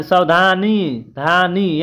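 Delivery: सावधानी drawn out slowly with a long final ई; teacher slowly sounding out the long ई ending of सावधानी. Shown as a demonstration. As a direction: stretch the धा and नी of सावधानी and धानी. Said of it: "बड़ा ई का उच्चारण किया जा रहा है".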